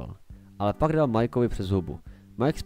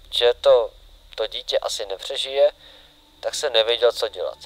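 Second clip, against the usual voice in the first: louder, emphatic first-person account; diary reading; 8 dB louder than usual. Acted. William Afton confesses in his diary, loud and dramatic. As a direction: read it out forcefully in short, dramatic phrases.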